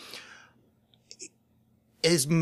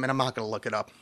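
ordinary talk

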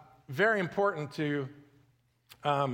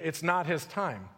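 normal talking voice

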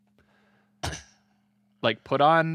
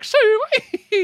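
mock rural accent; 7 dB louder than usual. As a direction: whoop it like a backwoods yokel. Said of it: "they're like".

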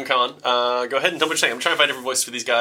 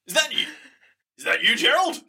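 surprised call; 13 semitones higher than usual. Acted. Guards call into trees.

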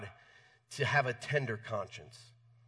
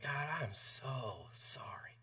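hushed, breathy whisper of remorse; whispered prayer; 11 dB quieter, slower than usual. Retold or imitated. Convicted prayer while driving.

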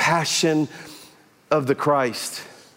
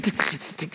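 soft unvoiced tick tick tick; imitated tapping claws; 3 semitones lower, 8 dB quieter than usual. Shown as a demonstration. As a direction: whisper the clicks of little claws on the floor. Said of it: "this little tick".

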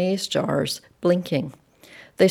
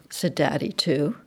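plain reading voice

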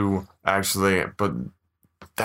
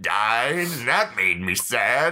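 put-on old prospector voice; 6 semitones higher than usual. Prospector Batman story continues.

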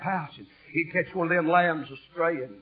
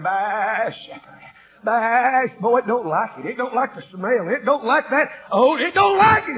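mock lamb baa building to a high, loud shout; hammed-up lamb bleat; 7 semitones higher, 8 dB louder than usual. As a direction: play the lamb crying out.

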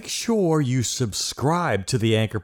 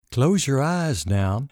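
guided meditation; 3 semitones lower than usual. Acted. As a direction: ease the listener into stillness, unhurried.

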